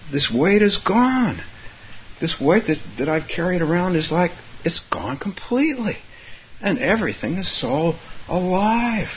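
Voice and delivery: acting out joyful release, pitched well up; retold elation. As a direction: imitate someone overjoyed at feeling free. Retold woman exulting in freedom.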